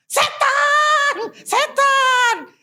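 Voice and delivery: high shrieking yell of terror; terrified scream. Retold or imitated. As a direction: scream it like you've seen a demon. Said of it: "Dia teriak dengan bahasa mereka". (jeritan ketakutan yang melengking)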